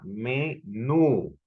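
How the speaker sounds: "menú" split slowly into ME and NÚ; syllable-by-syllable demo. Shown as a demonstration. Teacher repeating the word for students, syllabified "menú". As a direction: say "menú" in two drawn-out syllables, NÚ stressed. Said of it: "repito nuevamente la palabra".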